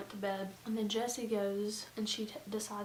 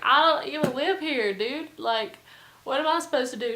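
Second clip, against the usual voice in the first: lightly imitated drunk, louder; drunk guy; 4 semitones higher, 10 dB louder than usual. Drunk guy at the party protesting, lightly mimicked loud voice. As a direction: protest loudly as a tipsy party guest. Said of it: "he's drunk and he's like".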